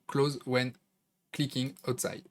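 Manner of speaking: English words in a French accent; self-mocked English accent. Real, not acted. Tutor reads an English option name, self-mocked French-accented English. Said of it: "Super accent anglais".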